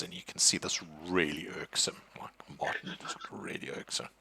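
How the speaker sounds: whispered aside. Real, not acted. Game master aside to players, whispered. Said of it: "unvoiced whisper".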